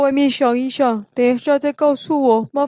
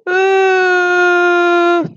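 a higher, louder tearful sob; acted sob; 5 semitones higher, 4 dB louder than usual. Xixi crying harder after confessing, loud sob. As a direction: let out a sharp sob. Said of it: "西西这时候哭得更大声了".

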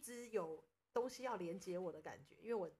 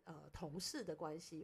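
usual speaking voice

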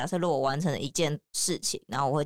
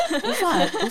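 laughing; 11 semitones higher, 7 dB louder than usual. Real, not acted. Laughing at the absurd idea.

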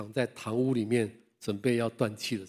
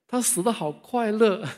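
amazed; 10 semitones higher, 5 dB louder, slower than usual. Real, not acted. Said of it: "laughing speech".

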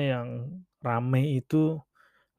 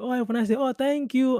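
high-pitched, lilting acted pleasantries; mock-delighted reply to a compliment; delighted thanks; 8 semitones higher than usual. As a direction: gush politely in a raised voice. Acts out delighted compliment exchange.

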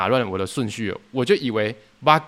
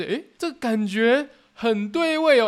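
savoring approval; 9 semitones higher, slower than usual. Retold or imitated. Customer loves the drink.

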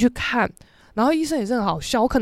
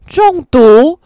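shocked; 3 semitones higher, 10 dB louder than usual. Real, not acted. Shocked at diagnosis.

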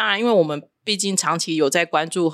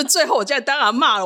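ordinary delivery

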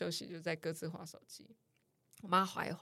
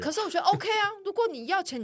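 higher and louder, exasperated; worked-up rant; 12 semitones higher, 8 dB louder than usual. Real, not acted. Daughter ranting about mom's video habit, exasperated and loud. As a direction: blurt it out, fed up.

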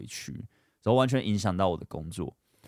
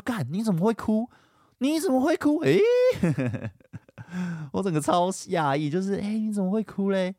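disbelief; 12 semitones higher, 4 dB louder than usual. Retold or imitated. Shocked that girlfriend is crying.

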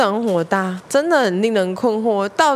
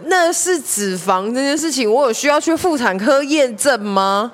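usual speaking voice

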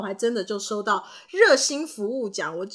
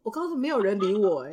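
normal talking voice

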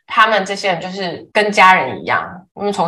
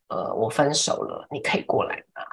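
imitating a choked-up friend; 8 semitones lower, 8 dB quieter than usual. Retelling a heartbroken friend's call, mild choked voice. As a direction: quietly mimic a friend's tearful plea. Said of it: "他就是那种哽咽的声音说".

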